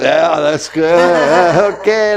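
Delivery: slow, drawn-out mock lust; hammed-up desire breaking into a laugh; lustful voice. Shown as a demonstration. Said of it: "have lust from your body".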